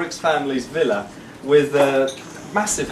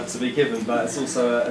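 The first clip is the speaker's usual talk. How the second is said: normal talking voice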